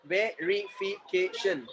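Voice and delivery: "verification" slowly split into separate syllables. Shown as a demonstration. Teacher showing the class how "verification" divides, syllable by syllable. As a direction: say "verification" in slow, distinct beats. Word said whole, then in syllables.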